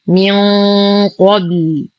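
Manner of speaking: من with a long heavy hum on ن before قبل; heavy nasal hiding. Shown as a demonstration. Teacher demonstrates the rule, long heavy nasal ن in من قبل. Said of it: "إخفاء حقيقي مع غنة كاملة مفخمة".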